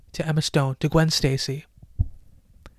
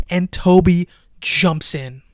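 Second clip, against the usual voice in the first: ordinary delivery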